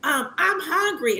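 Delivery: put-on very high, slow whiny child asking granny; grandchild's voice. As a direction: whine it up high like a hungry grandkid. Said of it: "They be like".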